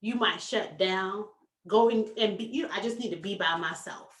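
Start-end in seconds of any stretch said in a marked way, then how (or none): 2.7-4.1 low-key, quick mutter; withdrawn, quiet. Acted. Speaker acting out the silent type who shuts down, quiet and withdrawn. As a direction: softly voice someone shutting down. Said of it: "you might just go quiet".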